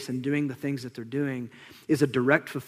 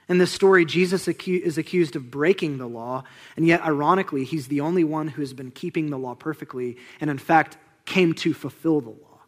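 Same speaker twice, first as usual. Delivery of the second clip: ordinary talk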